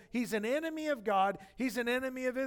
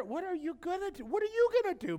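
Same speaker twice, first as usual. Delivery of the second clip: challenging big brother; 9 semitones higher, faster than usual. Retold or imitated. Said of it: "He just said".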